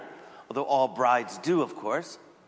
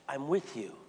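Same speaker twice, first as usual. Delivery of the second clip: much softer, gentle reassurance; soft parent comforting a child; tender comfort; 2 semitones lower, 8 dB quieter than usual. Retold or imitated. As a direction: hush the hurt child kindly. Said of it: "your instinct, of course, is to say".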